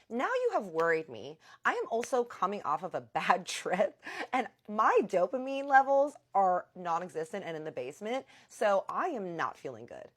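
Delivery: loud retold rant of worry turned anger; mad rant. Wife ranting at her missing husband, loud and upset. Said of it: "and now I'm mad".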